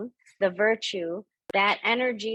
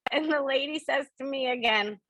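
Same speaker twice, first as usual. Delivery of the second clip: ordinary delivery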